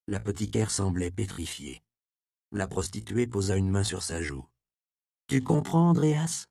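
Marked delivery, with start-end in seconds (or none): 5.3-6.5 high, pleading question; insistent repeat. Acted. Magdala repeats her warning to Andreas, high and pleading. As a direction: press him to understand. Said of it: "répéta-t-elle".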